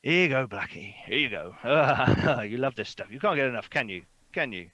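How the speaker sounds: lightly voiced doting bird owner; innkeeper fussing over bird. Gustav feeding his crow, playful coaxing. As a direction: coax a pet with a treat. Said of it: "and he goes".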